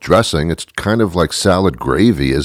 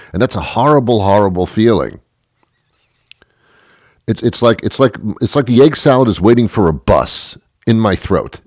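ordinary delivery

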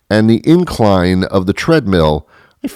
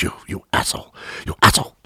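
unvoiced, out-of-breath insult; winded cursing; 7 dB quieter, much faster than usual. Winded patient cursing the tester over two more minutes, acted breathless. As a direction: gasp the curse with no voice left.

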